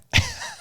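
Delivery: genuine amused laugh; real laugh. Real, not acted. Co-host teasing the self-described systems guy, amused laugh. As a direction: burst out laughing. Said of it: "I'm laughing right now".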